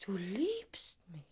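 slow, stunned whisper; whispered disbelief. Acted. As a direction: let the words fall out, astonished. Stunned at his confession.

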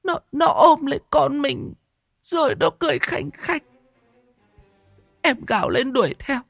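high, trembling, terrified account. A narrator's reading. Wife tells husband of the pregnant ghost stealing the child, terrified. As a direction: tell it as if still seeing the ghost.

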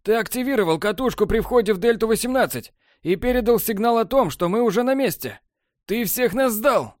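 high, rising accusation; angry accusation. A narrator's reading. Borland accusing Technar of betrayal, high angry voice. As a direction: throw the betrayal in his face.

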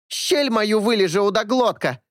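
высокий визгливый женский крик; визжит пьяная скандалистка; визгливая женщина. A narrator's reading. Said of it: "визжала какая-то женщина". (визгливый женский голос)

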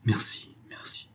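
breathless whispered thanks, barely voiced; stammered whisper. A narrator's reading. Overwhelmed lover stammers thanks to the girl, whispering.

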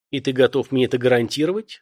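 usual speaking voice

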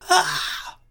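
long, very high scream of agony; dying cry of pain. A narrator's reading. Strong seized by the mixer's steel claws, high agonised scream. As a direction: shriek out a last cry of pain. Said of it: "exhalait son suprême cri de douleur".

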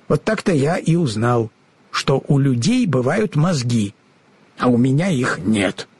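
usual speaking voice

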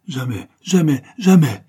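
building to a loud cry of never; earnest promise. Acted. Enfant promet à sa mère, de plus en plus fort.

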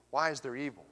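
normal talking voice

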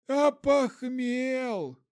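put-on high, very slow drunken plea; drunk undead voice. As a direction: drag it out like a hungover zombie. Мертвый бомж просит. (протяжный голос бомжа)

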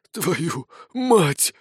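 rasped, breathless curse; hoarse wheeze. Acted. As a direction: wheeze it out mid-transformation. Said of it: "прохрипел он".

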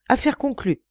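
a high, cutting "deal"; curt decision. Acted. Son closes the bribe bargain with the envoy, curt decisive reply. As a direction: settle it sharply as you stand. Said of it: "trancha Pak Chung Chang en se relevant".

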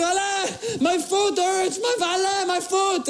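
high-pitched drawn-out plea for help; mock desperate cry of pain; hammed-up pained wail. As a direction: wail it in comic agony. Retold plea to Alain in pain.

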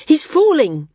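high, loud panicked cry; alarmed shout. A narrator's reading. Maris yelling that the flyer is falling. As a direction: yell the warning out.

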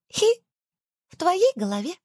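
high squeaky giggle; giggling tiny voice. Acted. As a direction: tease with a cartoon titter. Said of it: "Невидимка довольно хихикнул".

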